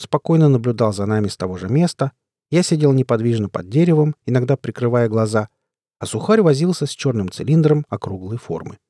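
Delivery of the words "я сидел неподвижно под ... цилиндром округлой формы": ordinary delivery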